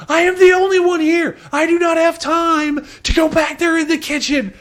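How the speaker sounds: high, loud, frazzled snapping; frantic waitress. Retold or imitated. Retelling the overwhelmed waitress yelling at the cook, high and loud. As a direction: shriek that there's no time. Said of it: "she goes".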